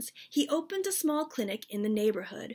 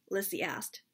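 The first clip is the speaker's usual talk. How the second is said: ordinary delivery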